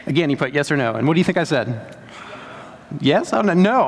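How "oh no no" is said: ordinary talk, nothing marked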